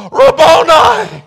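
high, loud, slow cry of recognition; cried out. A narrator's reading. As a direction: cry the name, overwhelmed. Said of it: "and fell at his feet".

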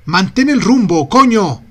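loud, cursing yell; angry shout. A narrator's reading. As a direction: bark it at her in anger.

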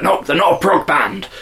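lightly heated mock protest; mock angry fans. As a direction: imitate outraged prog fans objecting. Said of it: "People get really angry".

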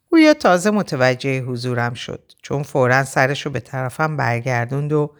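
ordinary delivery

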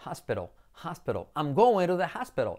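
"hospital" as HAS-pero; pronunciación correcta. Shown as a demonstration. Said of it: "pero con H". ("hospital" como «jáspero»)

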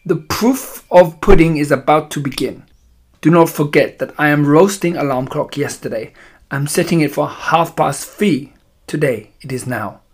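put-on imperious witch with a foreign accent; accented villainess giving instructions. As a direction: lecture your followers in a thick accent, drawing out key words.

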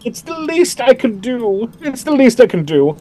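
sweet elf woman. Acted. Elf woman greets the returning twins, high cheerful welcome. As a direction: gush over the guests like a doting host.

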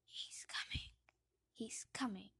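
eerie hissed whisper; Voldemort hissing. Acted. Parseltongue whispers filling the theatre, hissed. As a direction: hiss it like a snake. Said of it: "words said in an unmistakable voice".

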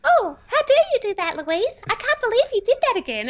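very high, fast cartoon mouse voice; hammed-up high cartoon scolding; silly cartoon voice. Shown as a demonstration. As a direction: turn the critic into a ridiculous cartoon. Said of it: "Change it to Minnie Mouse".

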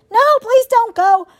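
high, loud plea through pain; pained, high-pitched begging; desperate pleading. Acted. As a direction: beg as a wounded animal. Wounded fox pleads for help.

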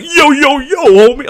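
very high, loud teacher imitation; hammed-up shout of forced street slang; mock homie greeting. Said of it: "sees the basketball".

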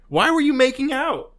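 worked-up, indignant squawking; exasperated rant. Acted. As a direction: scold them in mock disbelief. Mock rant at the kissing couple.

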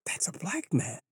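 hushed, wide-eyed mock whisper; stunned whisper. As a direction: whisper it like spotting rare wildlife. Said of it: "It's like".

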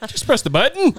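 Kiwi accent. Shown as a demonstration. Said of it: "I think you started getting Irish".

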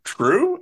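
"True?" shooting far up in pitch like a question. Retold or imitated. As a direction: turn "True?" into a very high, rising, unsure guess. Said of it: "I love how there's a question mark".

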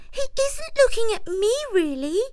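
very high, uneasy mouse voice; nervous self-reassurance. A narrator's reading. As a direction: talk yourself out of fear. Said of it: "he thought".

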